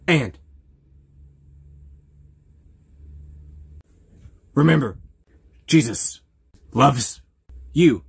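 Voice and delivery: booming, drawn-out declaration; loud proclamation. Acted. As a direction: proclaim it loudly.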